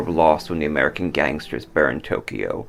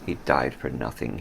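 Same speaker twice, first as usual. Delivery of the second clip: inner thought, quiet; 5 semitones lower, 6 dB quieter than usual. A narrator's reading. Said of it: "Hiro thought".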